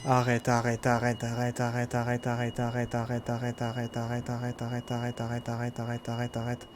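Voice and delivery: repeated plea fading to murmur. Real, not acted. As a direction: let it die away.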